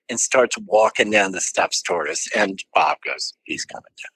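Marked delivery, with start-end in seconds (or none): 3.4-4.1 very soft, urgent whisper; thrilled whisper. Retold or imitated. Drummer whispers as George walks down the steps. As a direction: hiss it to your friend in excitement. Excited aside as George approaches.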